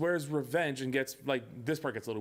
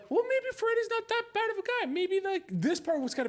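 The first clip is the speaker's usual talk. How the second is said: mock naive voice. Mocking filmmakers' reasoning.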